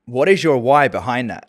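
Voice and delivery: put-on California surfer accent, loud; hammed-up California surfer dude; Cali Valley dude. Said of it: "Cali, like, Valley dude".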